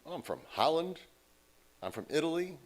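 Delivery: ordinary talk, nothing marked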